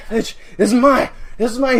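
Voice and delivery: loud, high hammed-up yell; worked-up imitation of a crazed fisherman; mock possessive threat. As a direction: imitate a wild man staking his claim. Acting out knife-waving McConaughey.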